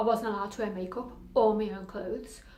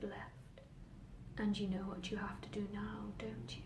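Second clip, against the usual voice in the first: hushed inner voice; 11 dB quieter than usual. Acted. Voices in her head coax the girl, hushed and insidious.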